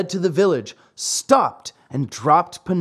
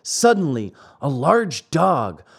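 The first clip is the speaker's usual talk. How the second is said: ordinary delivery, nothing marked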